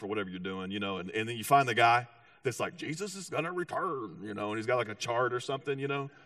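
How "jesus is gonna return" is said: lightly mocked TV end-times preacher; late-night preacher impression. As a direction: put on a hint of a doomsday preacher's delivery. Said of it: "why they all talk like that".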